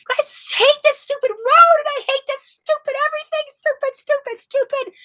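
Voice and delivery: imitating her own furious yell at the road; retold furious yelling. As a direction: relive the tantrum, hurling rocks and shouting. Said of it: "I was yelling".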